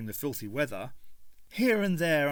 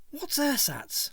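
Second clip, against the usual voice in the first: much higher, slow questioning; puzzled Ace; 13 semitones higher, slower than usual. A narrator's reading. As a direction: ask it blankly, baffled.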